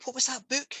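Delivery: high, wondering viewer asking himself; curious viewer voice. Retold or imitated. Speaker imitating a viewer wondering about a book, high and curious. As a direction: muse it like a puzzled fan. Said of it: "but they will think".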